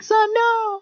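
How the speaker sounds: very high imitation of a mother; mock distraught mom. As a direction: plead as a horrified mom, hammed-up and high. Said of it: "and she'll be like".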